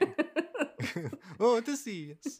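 put-on high, laughing Italian accent; mock Italian, high and playful. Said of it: "continue to do an Italian accent".

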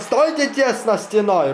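high, slow, loud plea; officer begging the crowd to hold together, shouting; desperate shout. Acted.

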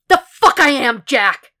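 high, loud, explosive refusal; furious defiance. A narrator's reading. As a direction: throw the order straight back at Jack. Acted quarrel, defiant refusal.

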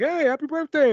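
high-pitched retold phone greeting; exaggerated friendly hello; cheery birthday greeting. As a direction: re-enact a bouncy birthday call.

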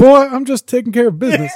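playful put-on old-coot voice; Grandpa from Terrorvision. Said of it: "I'm just thinking of Grandpa from Terrorvision".

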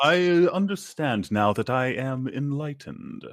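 put-on slow mid-Atlantic accent, lightly done; a hint of a mid-Atlantic accent, slow. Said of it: "starts using a mid-Atlantic accent".

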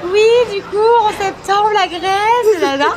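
high, loud, excited. Retold or imitated. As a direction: gush about going to Greece. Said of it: "on a sorti la même rengaine".

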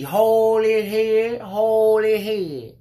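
high, loud, slow repeated yell; mock yelling lady. Imitating the lady still yelling from the next aisle. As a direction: holler it again and again. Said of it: "So mind you".

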